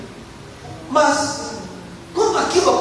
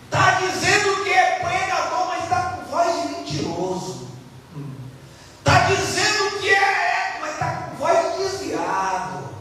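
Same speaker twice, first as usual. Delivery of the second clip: put-on higher, accusing old father; suspicious father; 6 semitones higher than usual. Blind father accusing the son posing as Esau, higher. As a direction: expose the liar's voice. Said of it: "o pai falou assim".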